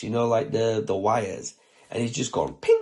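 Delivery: ordinary talk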